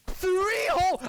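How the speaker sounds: high, loud disbelief on "Three whole"; stunned high "Three whole?"; shocked. Real, not acted. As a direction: blurt "Three whole?" in outrage. Shocked at tiny pay.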